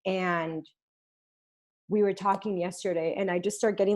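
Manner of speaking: ordinary talk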